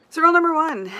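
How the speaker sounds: big excited announcement; loud announcement. Real, not acted. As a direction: call it out with energy. Announcing restarted roll.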